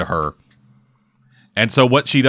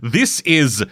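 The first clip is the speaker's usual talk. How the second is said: normal talking voice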